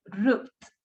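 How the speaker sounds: low "Roofed" with silent E; correct single syllable. Shown as a demonstration. Teacher repeats the right form, one syllable. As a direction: say "Roofed" with the ending straight after F. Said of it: "this is wrong".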